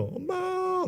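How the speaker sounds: high, drawn-out wail for mommy; mock baby cry. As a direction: imitate a baby waking up crying. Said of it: "울면서". (자다 깬 아기가 우는 흉내, 높고 길게)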